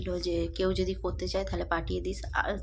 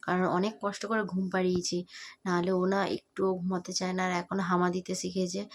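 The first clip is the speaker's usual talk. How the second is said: ordinary talk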